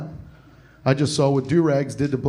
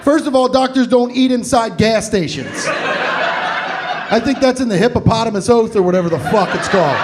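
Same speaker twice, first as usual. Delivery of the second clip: high-pitched, loud indignant rant; exasperated rant; 7 semitones higher, 3 dB louder than usual. Acted. Comedian ranting about doctors at gas stations, exasperated. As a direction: argue it point by point, worked up.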